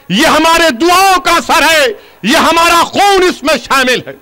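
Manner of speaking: rally the crowd, line upon line; impassioned rising refrain; rousing political oratory. Real, not acted. Builds up a political speech.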